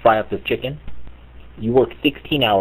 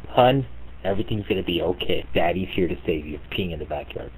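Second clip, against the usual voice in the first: soothing dad; 3 semitones lower, 7 dB quieter than usual. Retold or imitated.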